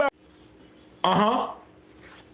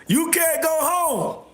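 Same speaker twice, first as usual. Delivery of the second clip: slow, higher mocking drawl; slow high taunting singsong; mocking repeat; 5 semitones higher, slower than usual. Real, not acted. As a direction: stretch it out to rub it in. Mocking repeat after laughing.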